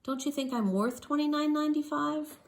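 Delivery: put-on high, gentle little girl; little girl softly asking her father; quietly. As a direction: ask sweetly and softly. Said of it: "Taya quietly said".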